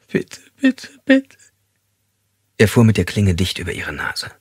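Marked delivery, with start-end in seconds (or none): 0.1-1.4 very high, sobbing pleas; terrified begging. A narrator's reading. Captive begs him to take the blade away, high and sobbing. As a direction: beg desperately through tears.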